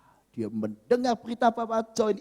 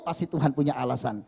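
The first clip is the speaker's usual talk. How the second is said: ordinary delivery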